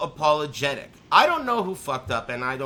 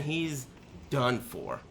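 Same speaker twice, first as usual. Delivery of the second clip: normal talking voice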